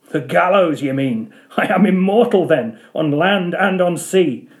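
mock the prophecy aloud; derisive cry. Ahab scoffing at the Parsee's hemp prophecy, derisive. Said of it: "cried Ahab with a laugh of derision".

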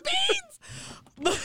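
high, slow, drawn-out hype; mock cheer from the sidelines. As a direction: egg him on in delight. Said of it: "in my head, I was like".

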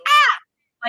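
high startled yelp; panicked yelp. Retold or imitated. Realising you said I love you too soon, high yelp. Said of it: "you're just like".

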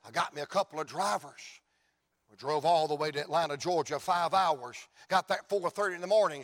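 ordinary talk

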